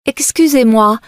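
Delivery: normal talking voice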